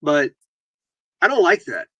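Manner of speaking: normal talking voice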